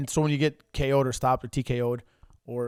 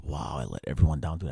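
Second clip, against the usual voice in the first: dejected low murmur; 11 semitones lower, 3 dB quieter than usual. Retold or imitated. Dejected after losing.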